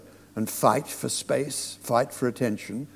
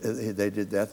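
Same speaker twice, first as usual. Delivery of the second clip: usual speaking voice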